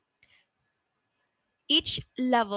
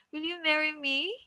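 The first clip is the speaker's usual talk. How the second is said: higher voice, climbing up to "me"; rising intonation; 5 semitones higher, 4 dB quieter than usual. Shown as a demonstration. Teacher repeats the yes-no example, rising intonation. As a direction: lift "me" high as a question. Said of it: "we have the rising intonation".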